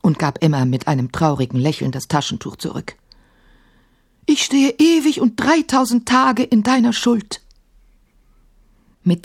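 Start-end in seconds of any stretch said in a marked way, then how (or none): 4.3-7.4 higher, louder genie voice; lightly voiced grateful genie; a hint of a grateful genie. A narrator's reading. Genie vows eternal debt to Emma, lightly voiced.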